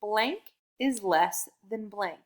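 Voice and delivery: slow, spelled-out sentence frame; slow model sentence. Shown as a demonstration. Teacher to young learner, slow sentence frame. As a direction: lay out each word for the child. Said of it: "let's finish the sentence today".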